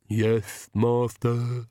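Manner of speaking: lisping servant voice; slow, lisped ridiculous servant voice; Igor. A narrator's reading. Servant answers the Count, in a ridiculous lisping voice. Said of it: "And the ridiculous voice, too".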